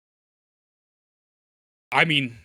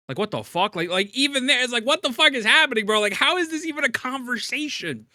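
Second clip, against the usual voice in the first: much higher, louder disbelieving outburst; exasperated yelling; 11 semitones higher, 4 dB louder than usual. Real, not acted. Ranting at defenders in the comments, shouting in disbelief.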